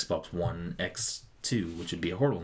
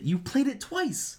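much higher, slow, mocking; squeaky high voice; 4 dB louder, slower than usual. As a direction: squeak it out slowly. Teasing exaggerated high voice.

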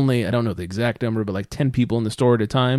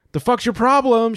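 angry retort; 8 semitones higher than usual. Retold or imitated. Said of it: "as I'm like".

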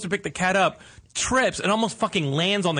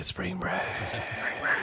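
"Spring break" whispered, no voice; 8 dB quieter than usual. Acted. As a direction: breathe "Spring break" as a secret. Repeated chant, then whispered.

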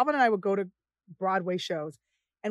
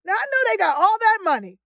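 mock puzzled; 8 semitones higher, 5 dB louder than usual. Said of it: "And I'm like".

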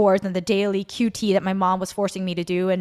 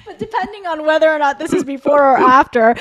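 laughing; 7 semitones higher, 5 dB louder than usual. Real, not acted. Laughing, high and loud.

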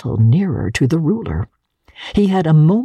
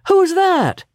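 high startled question; Fred startled, much higher; who could be at the door; surprised. A narrator's reading. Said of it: "cried Fred".

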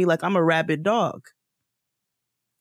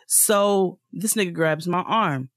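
ordinary talk, nothing marked

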